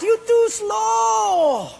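very high, drawn-out teasing sing-song; mocking laughing taunt. Portuguese guy mocking the Japanese guy, slow high taunt. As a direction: stretch it out slowly to rub it in. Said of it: "that Portuguese guy laughed".

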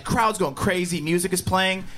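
ordinary delivery

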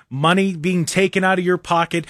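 ordinary delivery

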